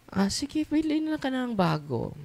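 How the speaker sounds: high-pitched sarcastic retort; mock advice. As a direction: teasingly play the priest's reply. Acted sarcastic reply to complainer.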